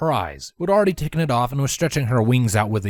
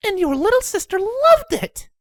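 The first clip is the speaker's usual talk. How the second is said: high mother voice; 5 dB louder than usual. Acted. Windy voiced.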